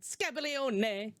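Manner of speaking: slow Scabaglione, drawn out; fake Italian. Shown as a demonstration. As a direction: repeat Scabaglione slowly like Italian. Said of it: "repeat after me".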